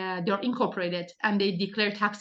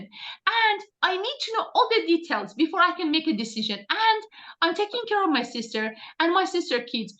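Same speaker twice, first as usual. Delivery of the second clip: higher voice of a worried prospect; imitating the Swedish woman; 7 semitones higher than usual. Imitating the struggling Swedish prospect explaining her situation. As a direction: play the struggling woman pleading her case, pitched up. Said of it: "somebody messaged me from Sweden and she's like".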